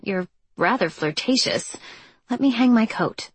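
ordinary delivery, nothing marked

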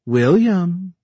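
high, loud sing-song calling from far away; feminine sing-song call. A narrator's reading. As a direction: call the name out in a teasing lilt, high-pitched. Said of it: "Gal's feminine sing-song voice calls out from far away".

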